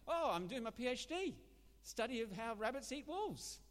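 high, loud cheerful rabbit; a chirpy rabbit proudly explaining its thesis. Acted. Joke, rabbit answers again.

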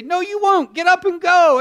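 high, loud firm command; hammed-up angel order; angels insisting. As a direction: insist he leave now. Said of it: "And they say".

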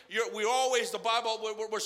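ordinary talk, nothing marked

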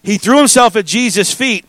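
ordinary talk, nothing marked

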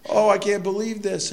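high, wailing lament for a wrecked car; mock sobbing wail. Preacher imitating a sobbing lawyer beside his wreck, mock wail. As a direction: ham up a grieving wail. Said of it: "the man is just sobbing".

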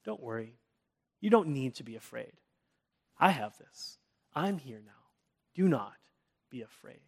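comforting them softly; gentle reassurance. Shown as a demonstration. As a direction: soothe a frightened listener. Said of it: "give you comfort or reassurance".

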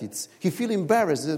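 normal talking voice

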